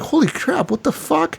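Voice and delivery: high, alarmed exclamation; startled shock. Retold or imitated. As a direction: yelp as if just punched. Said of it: "And I'm like".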